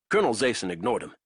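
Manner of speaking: usual speaking voice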